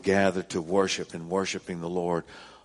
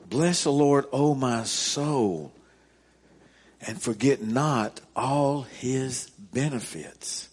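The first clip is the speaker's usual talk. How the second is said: slow, deliberate reread; 3 semitones higher, slower than usual. Acted. Pastor rereading the psalm so the congregation takes it in, slow and deliberate. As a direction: linger over every phrase of the verse. Repeating the verse slowly.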